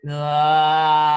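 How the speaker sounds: loud, sung-out ahh; saying ahh. Shown as a demonstration. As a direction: let the ahh ring out, opening up. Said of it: "we're gonna make an ah sound".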